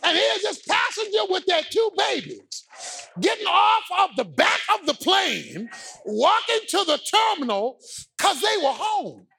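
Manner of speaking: high, shouted sermon climax; full-force preaching. Real, not acted.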